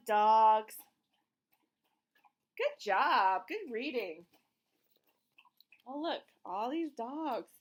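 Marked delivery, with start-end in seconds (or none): none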